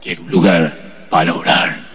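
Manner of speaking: low, slow, hollow voice from beyond the grave. Retold or imitated. Preacher demanding a prayer room, voice from beyond the grave. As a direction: drag it out like a ghost speaking. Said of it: "con voz de ultratumba".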